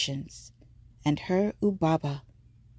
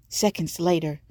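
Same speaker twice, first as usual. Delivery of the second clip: ordinary delivery, nothing marked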